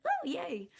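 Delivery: ordinary delivery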